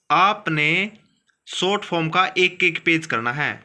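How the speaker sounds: normal talking voice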